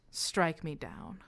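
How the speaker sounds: lightly voiced, coolly provoking the child; taunting illusion.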